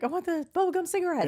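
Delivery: high-pitched imitation of an eager kid; playful little-kid voice asking for a treat; eager child. Child self answering grandpa in story.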